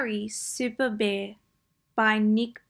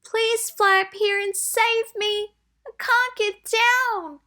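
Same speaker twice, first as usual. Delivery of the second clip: high, pleading little voice; frightened elephant stuck up a tree, high plea; 12 semitones higher, 4 dB louder than usual. Acted. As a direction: beg to be rescued, pitched way up. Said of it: "he said".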